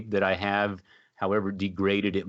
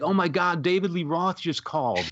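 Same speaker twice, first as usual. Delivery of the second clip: high-pitched mock panic; Kim; 9 semitones higher than usual. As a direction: imitate a girlfriend's breathless-news phone call, pitched way up. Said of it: "calls me in a panic".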